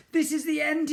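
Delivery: high, anxious inner voice; panicked thought. A narrator's reading. Ned falling toward the road, thinking in panic. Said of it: "he thought".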